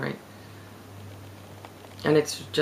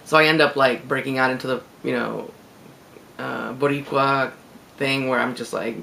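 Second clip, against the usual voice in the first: normal talking voice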